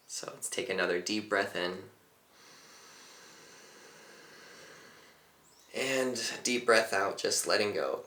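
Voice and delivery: calm teacher leading a breathing exercise; guided meditation. Acted. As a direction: talk them gently into the breath.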